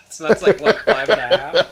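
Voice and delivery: talking through laughter; loud laugh while talking. Real, not acted. Laughing at a penis joke.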